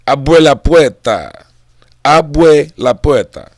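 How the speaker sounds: loud, slow Spanish-accented call. Shown as a demonstration. Showing open the gates in Spanish, loud Spanish accent. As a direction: draw it out loudly in a Spanish accent. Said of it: "Different accent, different language".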